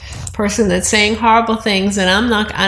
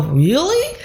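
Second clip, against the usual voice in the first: put-on higher, amazed little child asking really; wide-eyed toddler; 6 semitones higher than usual. Grandmother voicing her toddler grandson's amazed really, higher. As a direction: play a toddler whose eyes go big.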